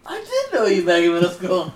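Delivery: high, loud, laughing exclamation; mock sudden recognition. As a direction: blurt it out as a comic realisation. Acts out imagined recognition, laughing.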